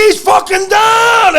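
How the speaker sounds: high, loud, slow yell. Retold or imitated. Wrestler yelling to the coach mid-match, full shout. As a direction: shout it across the wrestling room. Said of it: "And I'm like, yelling".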